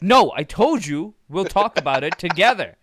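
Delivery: stern dad. Retold or imitated. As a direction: insist like a father who won't budge. Dad voiced, stern insistence.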